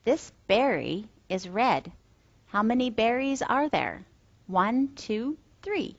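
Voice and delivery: gentle, singsong talk for toddlers; reading to a toddler. Acted. Teacher voice for toddler lessons.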